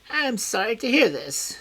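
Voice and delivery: high, false concern; high voice faking regret; high feigned sympathy. A narrator's reading. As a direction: put on sorrow he does not feel.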